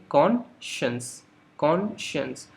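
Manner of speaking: कॉन्शियस said slowly as kon-shuns; model pronunciation. Shown as a demonstration. Teacher models the word for students, slowly and clearly. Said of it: "इस word को आप कैसे pronounce करेंगे".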